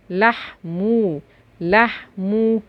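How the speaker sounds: لحمو slowly split as لح and مو; syllable breakdown. Shown as a demonstration. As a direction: sound out لحمو in two separate beats. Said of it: "ونحلل الكلمة". (تقطيع لحمو ببطء إلى مقطعين)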